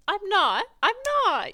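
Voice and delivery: loud denial. Real, not acted. Denies sounding unhappy.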